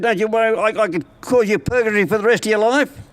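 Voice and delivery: a lightly voiced menacing devil. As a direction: threaten him as the devil. Devil threatening purgatory.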